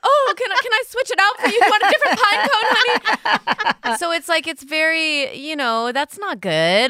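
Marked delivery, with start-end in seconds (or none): 0.0-4.0 much higher, louder, syrupy parent voice; doting parent. Retold or imitated. Parent offering the daughter a new pine cone, high syrupy coo. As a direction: overindulge her like a doting mom. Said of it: "And you're like".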